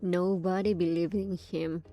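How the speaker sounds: ordinary talk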